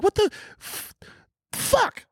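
put-on high-pitched kid cursing in surprise; young kid voice. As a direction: sound like a shocked little kid. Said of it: "they'd be like".

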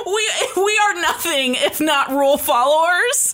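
giggling through the words; laughing. Real, not acted. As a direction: laugh while owning up. Joking about following rules.

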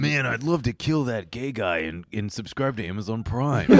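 casual low-pitched villain caricature; half-hearted impression, dropped low; Ted Bundy. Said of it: "Ted, Ted Bundy".

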